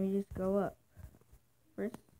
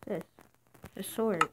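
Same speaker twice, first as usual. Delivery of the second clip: ordinary talk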